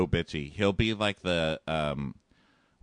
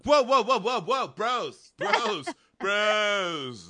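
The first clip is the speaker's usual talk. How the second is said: bro impression; 11 semitones higher, much slower than usual. Host imitating Josh stopping the guys on the stairs, high bro voice. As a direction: stop them cold like an outraged bro.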